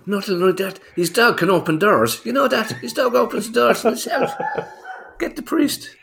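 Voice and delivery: hammed-up panic, calling for a priest; mock alarm. Mock alarm about magic dog.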